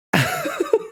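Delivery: squeaky burst of laughter; high laugh. Real, not acted. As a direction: giggle at the chat. Laughs at chat.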